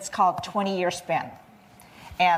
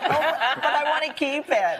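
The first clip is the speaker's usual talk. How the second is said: put-on reluctant child; 6 semitones higher than usual.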